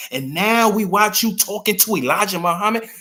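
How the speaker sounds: loud, high incredulous challenge; mock outrage. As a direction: raise it in disbelief, voicing the accusers. Voicing outraged plotters against King.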